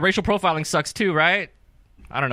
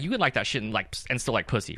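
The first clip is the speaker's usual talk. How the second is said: ordinary talk, nothing marked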